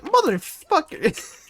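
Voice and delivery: high, slow, drawn-out mock swear; imitating his outburst at the crow; mock angry curse. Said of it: "So I was like".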